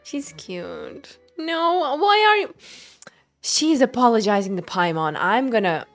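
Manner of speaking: usual speaking voice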